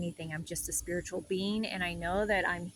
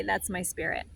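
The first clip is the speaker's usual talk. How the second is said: normal talking voice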